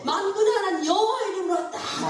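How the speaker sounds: high, ringing preacher's proclamation; bold declaration. Retold or imitated. David answering Goliath in God's name, boldly declaring. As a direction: proclaim it as a battle challenge.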